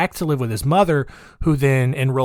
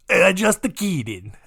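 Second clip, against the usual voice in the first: put-on higher, slow, teasing Italian accent; mock Italian accent; 6 semitones higher, slower than usual. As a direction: laugh it off in a jokey Italian accent. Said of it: "Where's my Russian accent? It's, it's Italian".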